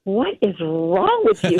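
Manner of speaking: slow, drawn-out confused husband voice; husband staring at his sobbing wife; baffled husband. Retold or imitated. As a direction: stretch it out like a puzzled spouse. Husband reacting to sudden bawling.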